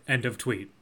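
low, soft gruff sign-off; deadpan old man's sign-off, quietly; James Caan sign-off. Retold or imitated. Co-host quoting James Caan's tweet sign-off, low and soft. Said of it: "he does sign off every tweet with".

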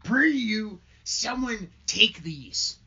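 put-on drawn-out plea from a heavily laden young minister; theatrical Hale voice.

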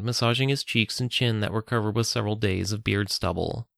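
plain reading voice

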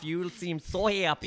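slow, sing-song Minnesota lilt; lightly put-on Minnesota cheer; a hint of Minnesota accent. Shown as a demonstration. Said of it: "Minnesota accent".

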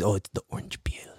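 hushed secretive Filipino uncle; whispered Filipino accent. Retold or imitated. Uncle whispering his secret ingredient, Filipino accent. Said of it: "can't I not do Filipino accent".

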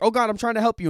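hammed-up frantic helper; panicked plea. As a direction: act out a friend freaking out, pleading to help. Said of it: "Jonesy is, like, freaking out".

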